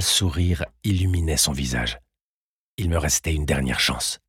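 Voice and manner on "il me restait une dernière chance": ordinary delivery, nothing marked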